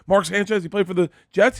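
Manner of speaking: high-pitched, retold nervous babble; spiraling rambling. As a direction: reenact rambling yourself into trouble. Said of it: "It was spiraling out of control".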